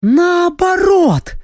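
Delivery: high, drawn-out joyful cry; beaming exclamation. A narrator's reading. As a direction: exclaim it with a sudden bright idea. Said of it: "воскликнула она, просияв". (радостно воскликнуть, просияв)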